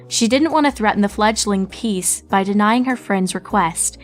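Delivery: ordinary delivery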